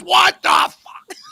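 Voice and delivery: huge, high, slow drawn-out yell; full shout. Retold or imitated. Showing the hosts what everyone hears him yell, a huge slow shout. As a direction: bellow it across the woods. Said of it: "I yell, I can't help it".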